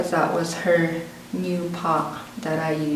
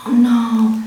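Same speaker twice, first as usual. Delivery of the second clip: a sinking, louder oh no; dismayed oh no; 5 semitones higher, 8 dB louder than usual. Retold or imitated. Kid smelling her burnt hooves, loud dismay. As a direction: realise the hooves are ruined.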